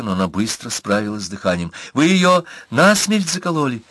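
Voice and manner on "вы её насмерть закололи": shaken. Acted. Girl repeats question, shaken.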